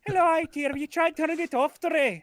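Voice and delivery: high-pitched Irish helpdesk; hammed-up Irish tech support; chirpy put-on Irish brogue; mock Irish accent. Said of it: "I'm gonna do an accent for you".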